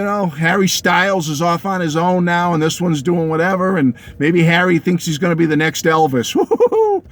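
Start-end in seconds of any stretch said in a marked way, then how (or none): 6.3-7.0 very high falsetto woo-hoo-hoo; mock awe. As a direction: hoot up high to ridicule the idea. Mocks Harry's supposed ambition.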